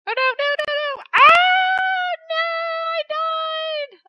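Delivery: high, loud wail of defeat; dismayed shout. Real, not acted. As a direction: cry out as you lose.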